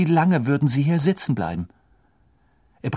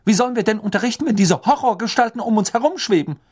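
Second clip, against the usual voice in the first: sharp; 9 semitones higher, 4 dB louder, faster than usual. A narrator's reading. McGonagall continues sharp protest.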